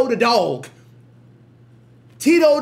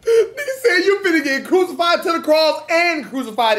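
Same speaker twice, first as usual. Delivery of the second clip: laughing; 6 semitones higher, 4 dB louder than usual. Real, not acted. Laughing while retelling the lyric.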